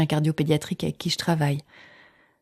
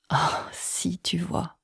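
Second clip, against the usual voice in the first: whispering; 7 dB quieter, much slower than usual. Retold or imitated. Silent reply to the doctor.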